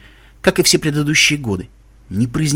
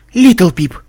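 higher, louder shocked exclamation; gasped; 6 semitones higher, 6 dB louder than usual. A narrator's reading. Velvet Remedy shocked at Little Pip, gasping. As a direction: gasp the name in alarm. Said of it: "ахнула Виолетта Ремеди".

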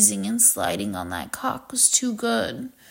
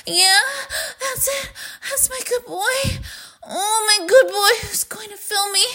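much higher, breathless and moaning, rising to cries; climactic moaning; 11 semitones higher, 3 dB louder than usual. Acted. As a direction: let it build into high, moaning cries. Building to climax with moans.

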